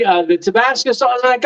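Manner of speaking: ordinary talk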